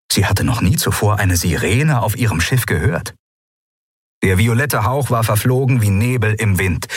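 ordinary delivery, nothing marked

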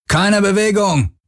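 viel höher und langsam gebrüllt. A narrator's reading. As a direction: den Befehl hinausbrüllen. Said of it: "brüllte einer von ihnen". (ein Syndolon-Soldat brüllt die Crew an, stehen zu bleiben)

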